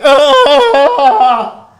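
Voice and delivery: loud, high yelp breaking into a laugh; mock startled yell with a laugh. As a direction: act caught out, yelping. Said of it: "And then he's like".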